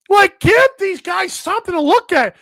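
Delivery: usual speaking voice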